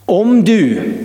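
usual speaking voice

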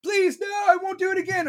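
put-on high, begging child voice; pleading child. Imagined child begging not to be hurt, high and pleading. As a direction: plead like a terrified kid. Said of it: "Be like".

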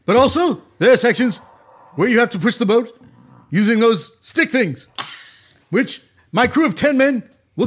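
emphatic, halting stump-speech captain; Bernie Sanders impression. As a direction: lecture the passengers like Bernie Sanders. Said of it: "this Bernie Sanders guy".

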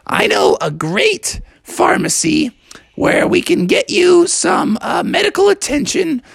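high cartoon mother voice; high-pitched, scratchy mom frog. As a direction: offer help in a squeaky voice. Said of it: "She's like".

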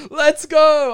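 high, loud shout of triumph; crazy celebration. Retold or imitated. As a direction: yell it like you just won. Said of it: "I go crazy".

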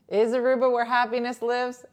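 high, loud anchor question; news anchor voice. Pretend. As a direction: announce it like a news teaser. Mock news anchor read.

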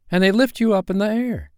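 put-on high, enthusiastic boy voice; lofty childlike lilt; airy boy explaining. A narrator's reading. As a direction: explain up high, full of wonder. Peter goes on explaining flight.

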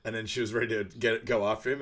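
ordinary delivery

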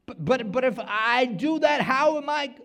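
high, loud stammering worrier; anxious churchgoer pleading; panicked doubter. Acted. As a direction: act out a nervous believer.

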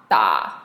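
low, dipping "da"; third tone. Shown as a demonstration. Teacher running through four tones on one sound, "da" low and dipping. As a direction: sink "da" right down low. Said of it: "all four tones in one go".

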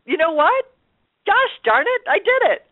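high, elated cheer; triumphant. Retold or imitated. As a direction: crow with delight. Triumph at making it.

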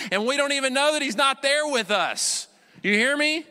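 high-pitched, urgent sermon climax; impassioned preaching. Real, not acted. Pastor warning church about empty shows, impassioned.

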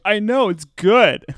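high, loud, drawn-out retort; emotional protest. Retold or imitated. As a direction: plead that it feels good, mock-emotional. Emotional, defending it to boyfriend.

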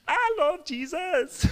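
slow, very high mock squeal; spaced-out enthusiast. Man on something declares his faith to a stranger, in falsetto. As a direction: sing out devotion in a goofy falsetto.

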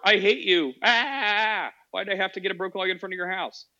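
high, bitter moaning complaint; whiny resentful enemy. Pretend. Enemy stuck in your yard, high resentful whine. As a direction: grumble it high and pained, hating to need help.

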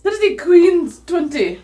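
excited. Real, not acted. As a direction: announce the big roll in triumph. Player rolls a natural twenty.

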